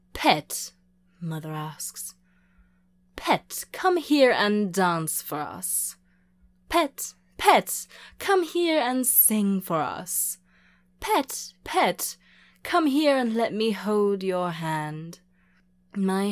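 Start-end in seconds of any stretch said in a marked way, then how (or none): 0.1-0.7 sharp loud summons; loud one-word call. A narrator's reading. Mother calls her pet over. 3.2-15.2 high, loud, slow summons; sing-out calling to a pet. A narrator's reading. Mother summons her pet to perform.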